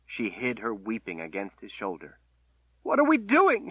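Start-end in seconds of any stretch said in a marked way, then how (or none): 2.8-3.7 much higher, louder anguished outcry; distraught cry. Acted. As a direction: cry out in despair. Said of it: "she cried".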